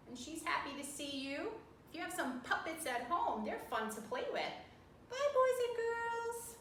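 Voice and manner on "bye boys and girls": high squeaky puppet character. Pretend. Puppet says goodbye.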